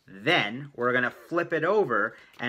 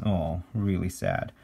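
mock sad; 6 semitones lower, 4 dB quieter than usual. Pities the sad drawn face.

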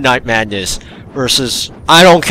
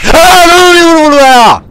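sudden high, loud yelp of fright; startled scream; 12 dB louder than usual. Real, not acted. Gamer caught by a jump scare, a high, loud startled scream. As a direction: cry out as the jump scare hits.

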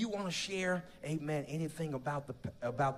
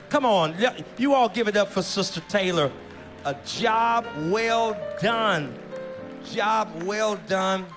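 rallying the applause; 3 semitones higher, 5 dB louder than usual. Real, not acted. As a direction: call out for applause, stretching the words. Calls congregation to applaud the artist.

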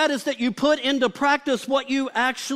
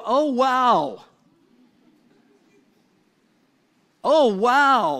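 mock amazement; 3 dB louder, much slower than usual. Imitating someone admiring themselves in the mirror.

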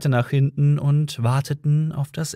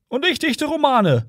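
very high-pitched boastful interjection; interjecting second policeman; 13 semitones higher than usual. A narrator's reading. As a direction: cut in as the other cop. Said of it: "fiel ihm der andere Polizist ins Wort".

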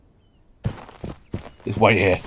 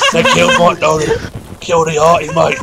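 put-on loud, high Australian-accented alarm; rally the troops over a fallen mate; mock-outraged shout; 6 semitones higher, 3 dB louder than usual. Mock outrage at own character's death.

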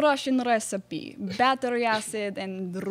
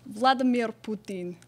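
mock Russian accent. Russian bachelorette Ivanka.

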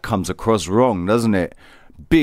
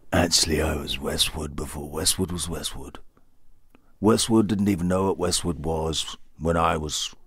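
much softer, low-voiced brag; low quiet boast; 4 semitones lower, 6 dB quieter than usual. Second persona bragging about Westwood, quiet and low. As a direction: boast it low and soft like a different character.

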